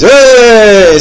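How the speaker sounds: high, loud, drawn-out cheer; celebratory cheer. Pretend. Podcaster celebrating winning as England, jubilant cheer.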